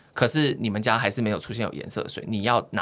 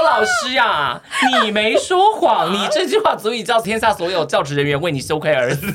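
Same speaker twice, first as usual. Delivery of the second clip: mock theatrical scolding; 9 semitones higher, 5 dB louder, slower than usual. Pretend retort to teacher.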